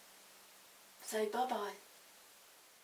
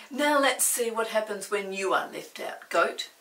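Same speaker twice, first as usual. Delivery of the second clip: stern order; 7 dB louder than usual. Acted. Bather orders Goat out.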